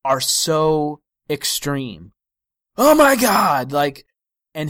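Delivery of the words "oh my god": high, loud overwrought gasp; mock shriek. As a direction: play it hysterical. Mocking overwrought phone call characters.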